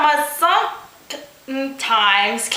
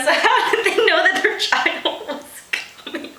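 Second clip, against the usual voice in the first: laughing; 8 semitones higher than usual. Real, not acted. Reader laughs at absurd text.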